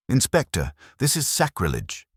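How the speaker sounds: shocked churchman crying out; indignant exclamation. A narrator's reading. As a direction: exclaim it, scandalised. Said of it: "he exclaimed".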